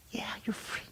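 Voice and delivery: soft, breath-only whisper; whispered assurance. Acted. Answering someone who asks if they're free, whispered.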